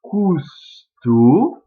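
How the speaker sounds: "Custo" allongé, un peu plus fort; étirer "Custo" syllabe par syllabe; très lentement. Shown as a demonstration. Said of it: "on le prononce très lentement". ("Custo" dit très lentement)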